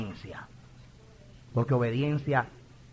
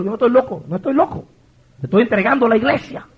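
higher, emphatic acted protest; insistent retort; 8 semitones higher, 6 dB louder than usual. Pastor telling his elder he is not crazy, insistent retort. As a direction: insist on it, voice raised.

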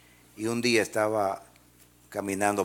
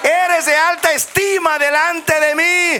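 much higher and louder, slow fervent preaching; preaching at full force; 11 dB louder, slower than usual. Acted. Preacher proclaiming God's words to the congregation, fervent shout. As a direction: proclaim God's word to the church.

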